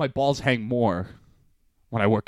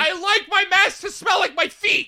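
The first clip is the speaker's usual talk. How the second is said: much higher, teasing falsetto; mock high silly voice. Friend teasing him about the mask, high mocking voice. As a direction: squeak it like a goofy kid.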